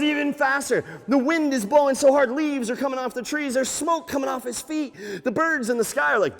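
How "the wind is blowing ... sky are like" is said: ordinary delivery, nothing marked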